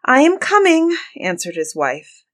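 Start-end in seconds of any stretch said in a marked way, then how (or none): 0.0-0.9 high, raised, anxious answer; calling back. A narrator's reading. Wife answering her impatient husband, calling back. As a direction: call back high and loud.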